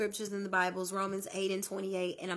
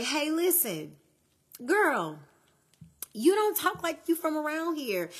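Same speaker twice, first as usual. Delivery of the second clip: higher, lightly voiced hometown gossip; playfully imitating the local girls, pitched up; mock small-town neighbor; 6 semitones higher, 3 dB louder than usual. Teasing a girl about how she talks, lightly mocking. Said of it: "So it was like".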